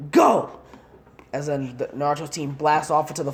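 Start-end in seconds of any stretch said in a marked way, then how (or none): 0.1-0.6 high, loud "Go!"; commanding shout. Acted. Proctor launching the teams into the forest, loud command. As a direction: send them off with a bark.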